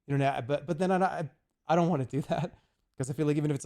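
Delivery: ordinary talk, nothing marked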